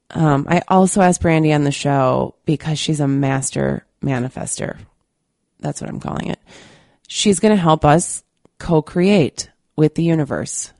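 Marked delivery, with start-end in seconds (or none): 5.6-6.4 whispered aside. Real, not acted. Whispered aside about her word.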